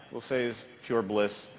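usual speaking voice

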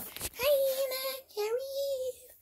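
lion toy voice. Acted. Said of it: "Lion go".

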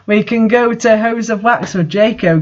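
ordinary talk

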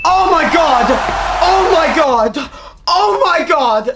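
high, loud, drawn-out cries; ecstatic disbelief. Real, not acted. Player stunned by a rare pull, high ecstatic shouting. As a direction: cry out amazed, stretching the words.

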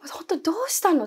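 high pressing question; urgent asking. Retold or imitated. Pressing the panicked roommate, high urgent question. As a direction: push gently but urgently for the truth.

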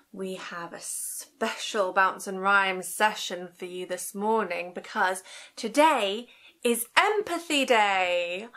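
usual speaking voice

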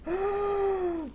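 long, high gasp; amazed gasp. Real, not acted. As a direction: gasp in wonder at the gift. Astonished at gift box.

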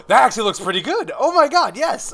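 high, loud, gushing delight; thrilled. Real, not acted. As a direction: burst out, amazed at your own work. Delighted with own drawing.

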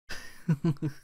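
short high chuckle. Real, not acted. Gamer amused by the unexpected attack, chuckling. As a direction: laugh it off briefly.

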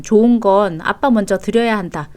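normal talking voice

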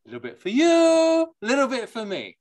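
high, lilting Yiddish accent on each half; sing-song it the Yiddish way, climbing on me; a playful Yiddish lilt sharing out the halves. Shown as a demonstration. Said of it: "if you say it with a Yiddish accent".

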